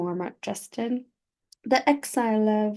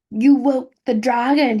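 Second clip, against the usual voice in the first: screamed; 2 semitones higher, 7 dB louder than usual. Acted. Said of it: "he screamed".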